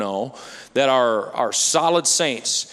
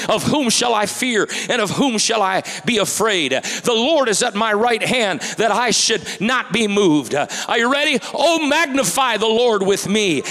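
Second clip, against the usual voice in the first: loud, high scripture proclamation; fiery psalm recital; preaching scripture; 7 semitones higher, 5 dB louder than usual. Acted. Preaching scripture at full force.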